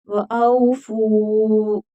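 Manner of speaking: वअऊफू with the फा stretched long; drawn-out tajweed demo. Shown as a demonstration. Teacher models वअऊफू for students, फा long and slow. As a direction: stretch वअऊफू slowly, lengthening the फा. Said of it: "फा को खींच कर पढ़ेंगे".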